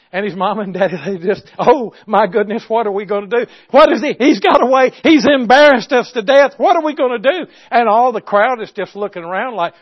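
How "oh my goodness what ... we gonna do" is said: loud, high flustered outburst; fretting in a raised, urgent voice; mock parental panic. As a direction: imitate horrified, embarrassed parents.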